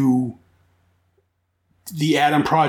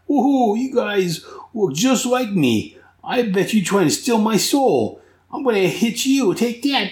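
much higher, gleeful comic villain; high, gleeful Punch voice; 8 semitones higher than usual. Retold or imitated. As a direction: play a giddy cartoon brawler.

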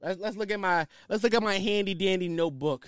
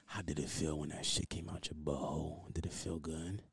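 hushed mock interviewer; 12 semitones lower, 13 dB quieter than usual. Mock questions, whispery low.

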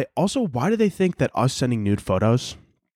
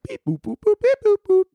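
high beep boop beeps, rising and louder; imitated Morse beeps; 4 dB louder than usual. Alien signal at NASA headsets, chirped high beeps.

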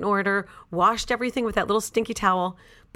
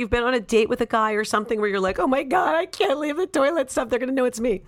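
mock panic; 6 semitones higher than usual. Appealing to the audience, acted panic.

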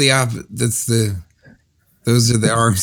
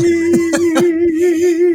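falsetto laugh. Real, not acted. Laugh in falsetto after song.

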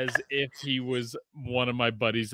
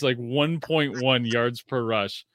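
usual speaking voice

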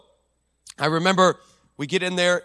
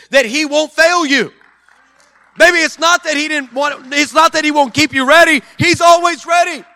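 higher, loud shouted climax; preaching shout; 8 semitones higher, 8 dB louder than usual. Acted. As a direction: build to a shout.

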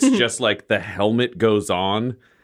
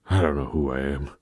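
lost, sad voice; 8 semitones lower, 4 dB quieter than usual. Acted. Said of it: "Are you ready to be the Forsaken".

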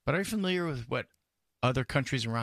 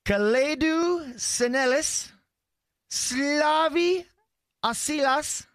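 hammed-up quiz reading; 11 semitones higher, 4 dB louder, slower than usual.

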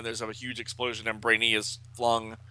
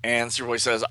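plain reading voice

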